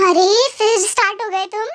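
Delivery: ordinary talk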